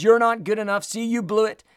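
scolding, grudging pronouncement from above; accusing voice. Acted. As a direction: act out the voice that shames the listener. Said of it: "do you hear another voice".

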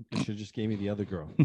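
muttered aside. Shown as a demonstration. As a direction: mutter it to yourself.

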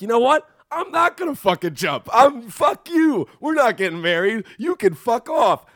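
high-pitched mock outrage; yelling, telling off. The man on the roof tells off his fiancee, yelling. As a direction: let rip with indignant, stretched-out insults. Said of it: "after he's finished yelling at his fiance".

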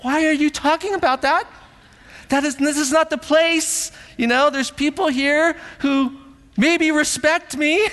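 hammed-up panic of a dad in public; mock embarrassed dad.

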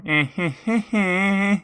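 high breathy heh heh heh; panting dog laugh. Retold or imitated. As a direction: pant out a nervous little laugh. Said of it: "You shoot a glance towards Tor and go".

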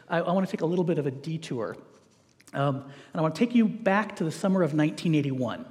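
normal talking voice

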